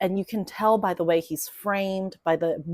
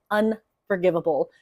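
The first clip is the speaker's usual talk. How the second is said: ordinary talk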